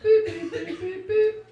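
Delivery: very high electronic beeping; shrill, evenly pitched beep noises; imitated beeps. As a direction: squeak out machine beeps. Imitates voicemail machine beeps.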